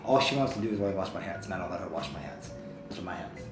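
normal talking voice

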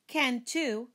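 mild sibling retort; countering. Acted. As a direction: throw the contradiction right back. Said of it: "he countered".

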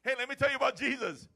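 high, loud eager greeting to a stranger; cheery street pitch. Retold or imitated. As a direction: bound up and blurt it out brightly. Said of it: "and you go up and say".

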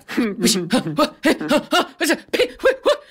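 little huffs and squeaks of indecision; wordless flustered noises, glancing between each other; mock-panicked stammered puffs; imitated speechless sputtering. Imitates three exchanging looks wordlessly.

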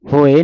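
ਹੋਏ with no second h; dropped h. Shown as a demonstration. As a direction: glide from o to e in ਹੋਏ. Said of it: "ਨਹੀਂ ਪੜ੍ਹਨਾ". (ਹੋਏ, ਦੂਜੇ ਹਾਹੇ ਤੋਂ ਬਿਨਾਂ)

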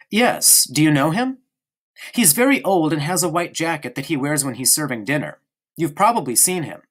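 put-on a small boy prattling on to his friend; young boy Bruno. Bruno voice again.

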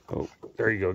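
normal talking voice

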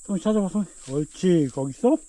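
high, coaxing pet talk; talking to a dog. Real, not acted. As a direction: urge the dog to search. Training dog to find mushrooms.

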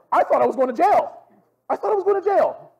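high, loud panicked outcry; mock panic. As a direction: cry out like a terrified kid, played up. Reliving childhood panic at being caught.

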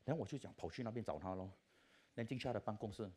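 usual speaking voice

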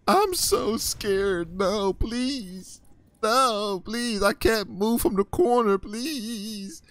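high, slow whimpering plea mocking campers; high mock-frightened pleading; mock scared kid. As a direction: whine and beg from the corner. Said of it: "That's what they sound like".

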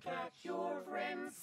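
whispered. Pretend. Whispered echo of game title.

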